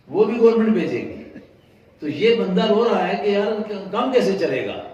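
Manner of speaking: loud, high, fast mocking cry; preaching climax. Preacher mocking the worried man, loud and high. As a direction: throw it out at full force.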